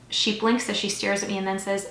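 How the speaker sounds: ordinary delivery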